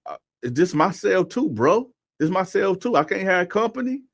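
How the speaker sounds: high, rising, whiny-edged questioning; imitated protest. Cellmate defending his visitor, imitated indignant protest. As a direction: plead your case as the challenged cellmate.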